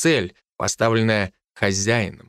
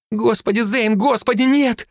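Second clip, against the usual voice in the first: wailing lament; 9 semitones higher than usual. A narrator's reading. Wife wails as the swordsman closes on husband. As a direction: wail in despair as he lunges at her husband. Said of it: "запричитала женщина".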